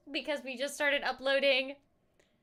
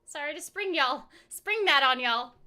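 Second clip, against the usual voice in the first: usual speaking voice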